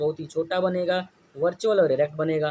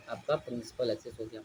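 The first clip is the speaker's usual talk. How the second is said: आपका said softly, much quieter and lower; quiet answer; 7 semitones lower, 9 dB quieter than usual. Shown as a demonstration. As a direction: murmur the answer to your own question. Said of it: "ये क्या हो गया?". (बहुत धीमे से जवाब)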